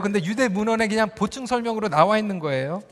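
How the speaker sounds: ordinary talk, nothing marked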